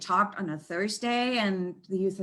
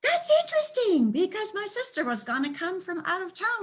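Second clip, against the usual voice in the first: much higher put-on voice of the dog owner; imitating the woman; 10 semitones higher than usual. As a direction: play the surprised owner, high. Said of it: "And she says".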